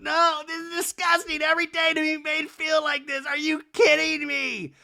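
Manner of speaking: high-pitched hammed-up indignation; mock outraged rant. Kyle pushing past Dorit, mock outraged. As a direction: imitate a housewife in meltdown. Kyle still ranting outside.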